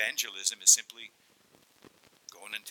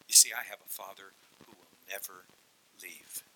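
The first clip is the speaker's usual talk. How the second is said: ordinary talk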